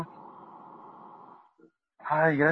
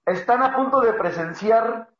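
mock race announcer; 2 semitones higher, 4 dB louder than usual.

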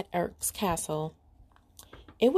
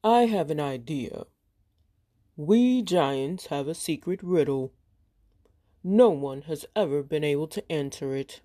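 lower, lightly booming giant; 3 semitones lower than usual. Acted. Giant speaks after narration.

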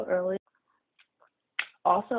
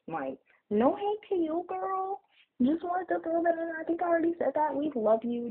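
high sweet voice; 13 semitones higher than usual. Acted. Sweet apology to a subscriber.

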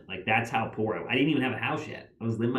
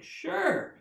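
much higher, overeager yes; eager fake confidence; much slower than usual. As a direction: squeak out a bluffing yes. Pretending to have a podcast.

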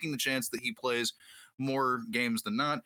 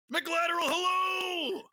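excited shout; slower than usual. Real, not acted. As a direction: call out the co-host's name. Greeting co-host with shout.

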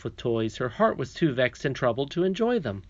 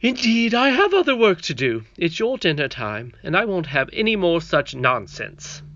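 stern nurse; 5 semitones higher, 5 dB louder than usual. Acted. Nurse scolding the princess.

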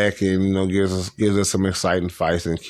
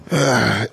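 short, voiced sigh; resigned sigh; 4 semitones higher, 3 dB louder than usual. Real, not acted. Podcaster accepting a disappointing result, resigned sigh.

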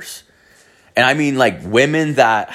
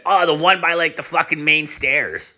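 higher-pitched imitation of a coworker; lightly voiced buddy, pitched up; buddy's answer; 6 semitones higher than usual. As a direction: act out his casual reply higher.

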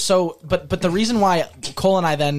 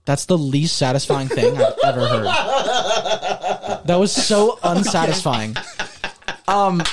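talking through laughter; laughing. Real, not acted. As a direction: giggle between the words. Laughing at failed ASMR.

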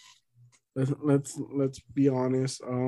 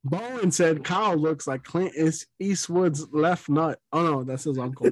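ordinary talk, nothing marked

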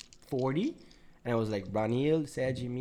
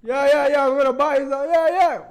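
put-on much higher, loud drunk yammering; imitating drunk friend; 14 semitones higher, 9 dB louder than usual. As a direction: blurt it like a drunk who won't listen. Voicing drunk Milo.

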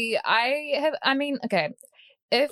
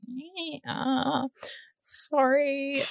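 higher-pitched, hesitant, mock-sheepish sorry; awkward apology; 6 semitones higher, much slower than usual. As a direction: play an awkward apology video with an uneasy pause. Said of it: "would just be really awkward".

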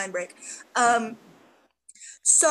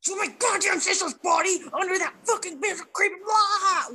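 shouting; 7 semitones higher than usual. Retold or imitated. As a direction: lose it and shout. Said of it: "Loses her mind".

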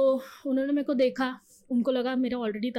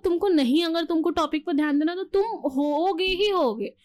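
ordinary talk

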